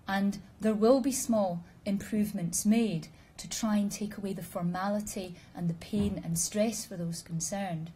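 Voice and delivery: ordinary talk, nothing marked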